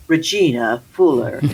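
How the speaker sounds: low mock pastor voice on the name. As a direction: intone the name low, imitating him.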